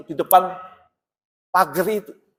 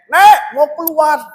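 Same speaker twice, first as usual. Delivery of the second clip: calling grandma; 8 semitones higher, 7 dB louder, slower than usual. Retold or imitated. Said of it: "saya itu seringkali panggil itu nenek".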